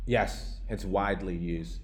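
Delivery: usual speaking voice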